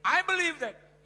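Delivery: shouted conviction, high; preacher's loud affirmation; loud declaration. Real, not acted. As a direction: proclaim it loudly. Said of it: "Yakin saya".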